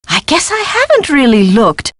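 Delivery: ordinary delivery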